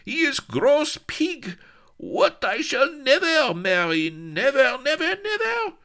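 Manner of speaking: put-on very high, loud, accented screaming; shrieking accented fury; furious tantrum. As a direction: rant wildly, stamping. Tantrum about betrothed.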